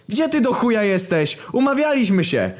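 high, loud angry yell. Acted. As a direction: yell at him down the phone. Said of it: "wydarł się papież". (wrzask do telefonu przez głośnik)

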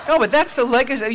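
laughing through the words. Real, not acted. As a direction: laugh it off after the joke. Laughs at own joke.

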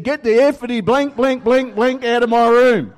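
high, loud angry yell at her brother; sister yelling. Retold or imitated. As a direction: scream him out of the room.

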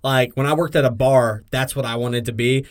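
normal talking voice

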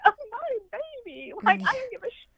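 high-pitched, distraught outburst; upset, high retold lament; retold anguish. Retells her grief amid happy crowd.

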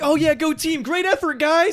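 high, mock-supportive team cheer; bench cheering. Imitates bench players cheering.

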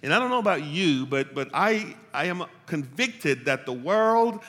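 loud, high-pitched sermon delivery; full-force preaching. Real, not acted. Preacher urging the church to contextualize without compromise, full-force preaching. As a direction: preach it out loud and high, driving the point home.

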